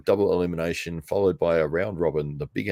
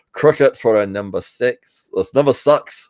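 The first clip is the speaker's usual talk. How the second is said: louder, mock New Zealand accent; Kiwi accent; 6 semitones higher, 8 dB louder than usual. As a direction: put on a hammed-up Kiwi accent. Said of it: "hear it from us in Kiwi land".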